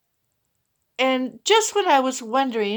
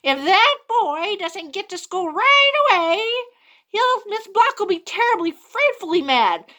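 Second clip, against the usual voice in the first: higher and louder, insistent; cat argues the boy must go, high; bossy cat warning; 8 semitones higher, 4 dB louder than usual. Acted. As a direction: warn like a fussy cat. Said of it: "I heard one of 'em say".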